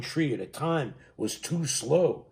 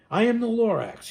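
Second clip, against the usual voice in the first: higher, louder Lorax, spluttering; coughing and whiffing; 7 semitones higher, 5 dB louder than usual. A narrator's reading. Lorax returning with more gripes, coughing and whiffing. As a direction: puff it out between coughs. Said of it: "he coughed and he whiffed".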